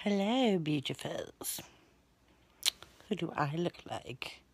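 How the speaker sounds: soft whispered greeting; whispering. Pretend. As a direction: whisper the hello sweetly. Whispers to the camera in a filter.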